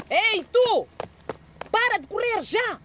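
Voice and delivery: very high yelled command; shouting. Acted. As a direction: yell after a fleeing man. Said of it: "gritou o sargento".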